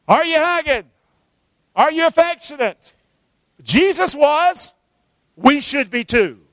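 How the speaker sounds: loud, high, punched challenge to the room; preaching shout. Real, not acted. Pastor challenging his congregation to be affectionate, preaching shout. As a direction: call it out with full pulpit force.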